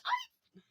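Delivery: imitated dog yelp, high and loud. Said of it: "And the dog goes".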